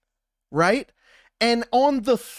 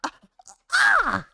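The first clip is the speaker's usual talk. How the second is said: high, startled "ah" in mock dismay; hammed-up outburst; high mock cry; 12 semitones higher than usual. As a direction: let out a high exaggerated cry. Mock reaction to the news.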